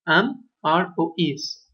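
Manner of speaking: each form spelled out slowly; slow listing. Shown as a demonstration. Teacher listing the verb forms to students, slowly. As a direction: lay out am, are, is one by one. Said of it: "es la forma del verbo to be".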